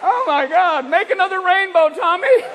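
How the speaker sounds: half-hearted stoner wonder at the flames; stoned firefighters. Retold or imitated. Firefighters on weed gawking at the fire, mildly giddy. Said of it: "the guys fighting the fires are like".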